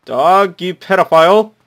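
loud, high furious yell at the dog; screaming insult at a dog; angry yell. Acted. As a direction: bellow the insult. Angry shout at NPC dog.

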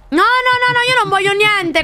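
high, frantic string of nos; panicked refusal. Real, not acted. Streamer refusing what the figure offers, panicked refusal. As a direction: refuse while badly spooked. Frightened refusal after a scream.

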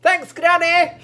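high, loud mock-cheerful shout; cheery thanks. Purple calls back to Granny, high cheery shout. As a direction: call back gratefully at full pitch. Director acts the visitor's reply.

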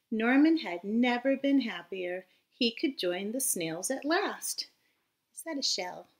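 lively storybook read-aloud voice; reading to children. A narrator's reading. Teacher reading a storybook aloud to her class, lively. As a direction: read it bright and animated for little listeners. Reads picture book to children.

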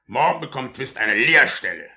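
loud, high commanding voice; stern orders. Acted. Beadle ordering the matron to bring the boy, loud. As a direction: lay down the law.

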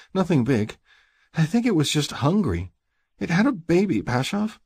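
high-pitched, mildly shaken female character; shaky woman. A narrator's reading. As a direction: plead softheartedly in a high woman's voice.

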